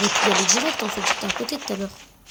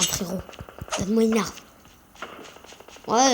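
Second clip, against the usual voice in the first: ordinary delivery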